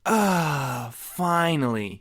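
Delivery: slow sighing relief; relieved sigh. Acted. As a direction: let it all out with a long sigh. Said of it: "he sighed with relief".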